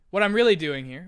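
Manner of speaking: usual speaking voice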